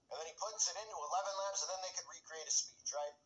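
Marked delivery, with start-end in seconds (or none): none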